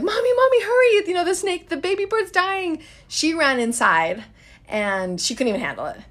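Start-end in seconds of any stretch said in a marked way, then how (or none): 0.0-2.8 panicked daughter. Retold or imitated. Mother voicing her daughter begging her to save the baby bird. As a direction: cry out like a little girl begging mommy to hurry. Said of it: "she was like".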